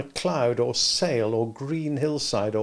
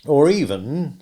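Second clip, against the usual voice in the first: Joe's voice; 4 semitones higher, 7 dB louder, slower than usual. A narrator's reading. Joe voiced.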